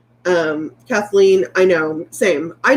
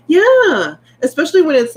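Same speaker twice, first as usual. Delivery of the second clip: emphatic; 9 semitones higher, 6 dB louder than usual. Real, not acted. Agreeing emphatically about patches.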